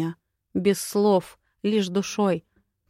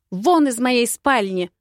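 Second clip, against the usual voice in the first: loud, higher furious order; angry acted shout; 4 semitones higher, 6 dB louder than usual. A narrator's reading. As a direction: yell to throw him out. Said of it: "Я перешла на крик".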